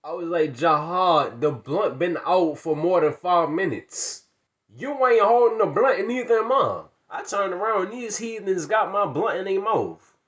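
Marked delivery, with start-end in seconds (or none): 0.5-6.8 loud, heated accusation; angry. Retold or imitated. Friend caught lying about smoke, angry and loud. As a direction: call him out, getting louder.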